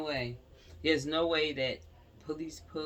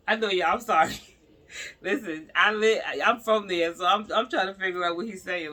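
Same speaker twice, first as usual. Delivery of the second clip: loud, higher, laughing through the apology; laughing loudly; 4 semitones higher, 9 dB louder than usual. Real, not acted. Apologizing to viewers while laughing, loud. Laughing, explaining she's local.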